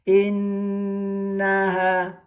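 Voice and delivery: innaha with nn held three beats, slow; held doubled n. Shown as a demonstration. Teacher demonstrating tasdid to students, nn held long. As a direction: sustain the nn of innaha, high and slow. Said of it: "ditahan tiga harokat".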